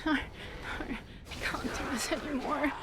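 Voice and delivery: soft, breathy, half-laughing despair; hushed, exhausted surrender to the game; defeated whisper. Real, not acted. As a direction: whisper it like you're about to give up. Frustrated at the game.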